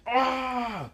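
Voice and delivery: high, sharp cry of pain; pained scream. Acted. Kabuto losing an arm, screaming in pain. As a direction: yelp as if an arm is cut off. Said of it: "Kabuto says".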